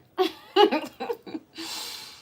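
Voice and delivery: high cackling villain laugh; evil laugh. Pretend. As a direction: cackle like a mustache twirling villain. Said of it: "This is my evil laugh".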